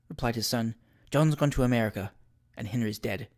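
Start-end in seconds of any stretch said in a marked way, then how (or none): 1.1-3.3 low, subdued, sombre report; quiet, heavy delivery; grim news. A narrator's reading. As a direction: break it grimly, sinking softer at the end. Son grimly reports brother's death.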